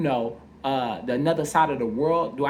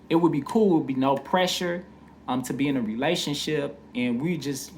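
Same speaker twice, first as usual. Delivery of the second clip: ordinary delivery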